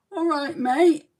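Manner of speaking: hammed-up Brum-Manc accent, chirpy hello; a put-on Birmingham-Manchester accent; bad Manchester accent. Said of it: "a cross between Birmingham and Manchester".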